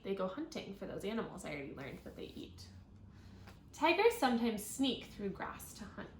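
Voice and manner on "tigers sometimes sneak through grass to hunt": bright, clear read-aloud voice; reading to children. Acted. Teacher reading a tiger book to her class, clear read-aloud.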